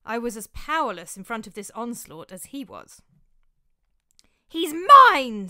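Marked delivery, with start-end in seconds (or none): 4.5-5.5 very loud, high screamed claim; jealous screaming fury. A narrator's reading. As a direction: scream it right in his face. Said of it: "screamed Cynthia".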